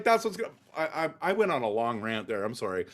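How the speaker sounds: normal talking voice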